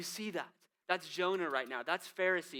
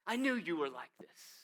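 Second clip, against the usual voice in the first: belittling spite; 4 semitones higher than usual. Acted. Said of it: "you can hear the belittling spite in his voice".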